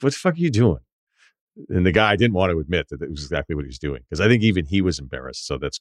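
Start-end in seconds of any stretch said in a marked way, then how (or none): 0.0-0.8 disdainful. Retold or imitated. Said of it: "I just had such a disdain".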